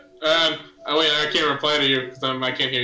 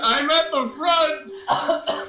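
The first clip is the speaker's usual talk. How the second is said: shouting over wind; 9 semitones higher, slower than usual. Acted. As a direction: call back against a gale.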